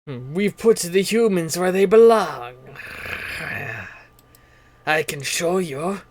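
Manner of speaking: slow, deliberate dark-side tempter; persuasive game character. Acted. Game master plays non-player character.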